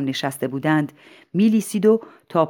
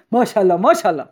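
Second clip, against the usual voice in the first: raised crowd voice, lightly acted; repeated crowd cheer; 4 semitones higher, 4 dB louder, much faster than usual. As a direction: repeat it like an approving audience.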